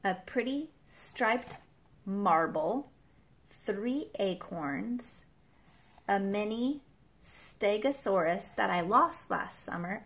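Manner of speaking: ordinary delivery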